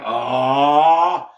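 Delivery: a lightly voiced disappointed crowd "Aw"; sound effect meme aw. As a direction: drop a short meme-style "Aw". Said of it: "the sound effect meme going".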